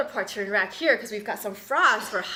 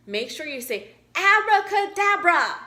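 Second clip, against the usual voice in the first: insistent turtle; 6 semitones higher, 7 dB louder than usual. Acted.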